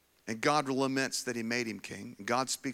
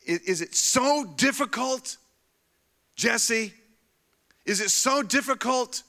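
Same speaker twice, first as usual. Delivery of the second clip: fiery preaching; 8 semitones higher, 7 dB louder than usual. Acted. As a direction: thunder it at Jesse like a pulpit challenge. Preaching at full force to Jesse.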